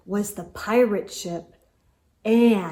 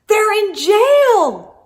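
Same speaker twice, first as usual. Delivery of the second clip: high, loud, slow cry of alarm; 11 semitones higher, 9 dB louder, slower than usual. Acted. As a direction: call it out in dismay. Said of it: "cried Dots".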